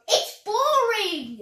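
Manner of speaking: loud, drawn-out moan; grumbling. Acted. Keeper bored in bed, slow grumbling whine. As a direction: drag out the complaint. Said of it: "he grumbled".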